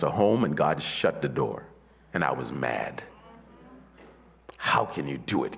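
quiet preaching. Acted. Preacher softly recounting closed doors to the congregation.

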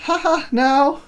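lightly voiced careless mom; airy mom. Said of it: "And she's like".

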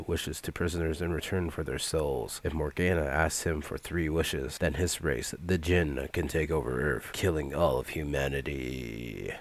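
quiet, low villain voice, lightly done; a hint of a sinister genie; low menacing Jinn voice. Shown as a demonstration. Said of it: "Try to say that in the best Jinn voice".

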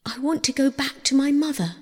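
high, hurried, plaintive voice; sobbing plea. A narrator's reading. Captive Persephone begs for her mother, high tearful plea. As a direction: plead in a high, tearful rush. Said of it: "sobbed Persephone".

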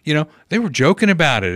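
ordinary talk, nothing marked

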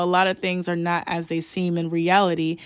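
ordinary delivery

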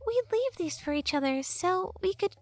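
high-pitched sassy fairy; Tattle fairy voice. Acted. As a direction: play a cheeky fairy girl, high.

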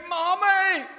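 loud, high call of Mommy; child shouting for mum. Retold or imitated. Boy who fell off his bike shouting for his mother, loud and high. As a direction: yell it like a hurt child running home. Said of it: "You shout".

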